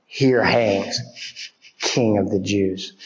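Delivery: mocking jeer. Preacher voicing the mockers at the cross, low contemptuous jeer. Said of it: "They're mocking him".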